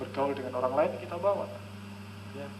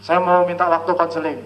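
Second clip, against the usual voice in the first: caller on the phone; 10 dB louder than usual. Retold or imitated.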